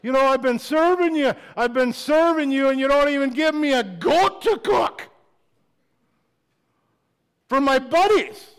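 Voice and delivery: loud, high, aggrieved protest; resentful complaint. Retold or imitated. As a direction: whine it out like the jealous older son. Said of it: "the one that's outside the party going".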